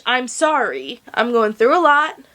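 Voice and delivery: ordinary talk, nothing marked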